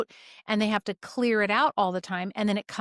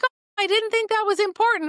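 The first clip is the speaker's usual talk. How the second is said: high, loud mock excuse; protesting patient; 12 semitones higher, 5 dB louder than usual. Patient excusing himself for not mentioning a symptom, mock innocent. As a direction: imitate a clueless patient, pitch way up.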